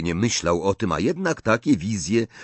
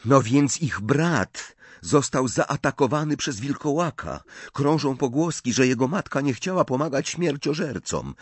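higher, earnest telling of bad news; Hermione explaining; 8 semitones higher than usual. A narrator's reading. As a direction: recount it gravely in raised pitch. (Hermiona opowiada, wyższym głosem)

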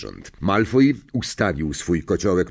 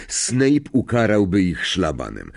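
ordinary delivery

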